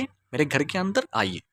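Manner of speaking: ordinary talk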